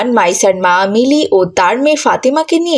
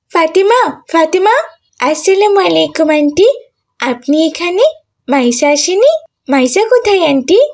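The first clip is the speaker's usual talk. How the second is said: put-on higher girlish voice with rising questions; schoolgirl Mili; 5 semitones higher than usual. Schoolgirl greeting her friend's mother and asking after her friend, questioning. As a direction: play a young schoolgirl, pitched up and questioning.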